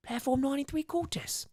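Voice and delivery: hammed-up bad British accent; terrible British accent. Shown as a demonstration. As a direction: do a deliberately awful British accent. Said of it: "hear a terrible British accent".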